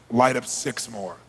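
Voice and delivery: slow, low-pitched order; controlled, measured instruction; calm command. Retold or imitated. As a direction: say it evenly and deliberately. Calm order before screaming.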